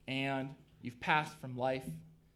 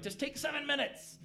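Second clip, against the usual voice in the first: higher-pitched, lightly imitated study leader; Nikki Gumbel; 10 semitones higher than usual. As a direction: lightly mimic a teacher urging the class, pitched up. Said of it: "Nikki Gumbel's like".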